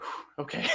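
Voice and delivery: breathy whispered sigh of relief; relieved whew. Retold or imitated. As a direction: let the air out, relieved, barely voiced. Relief after not being slapped.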